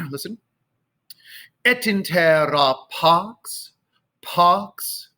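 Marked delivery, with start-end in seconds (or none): 1.6-5.1 slow "Et intera pax", paahh-ks; delay the final X of pax; late X on pax. Shown as a demonstration. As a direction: hold the vowel of "pax", X placed at the very end. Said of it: "careful where you're putting the, the X".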